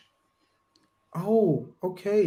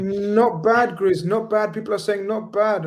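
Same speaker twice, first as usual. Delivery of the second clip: excited; 7 semitones higher, 4 dB louder, slower than usual. Real, not acted. Pleased with his own attempt.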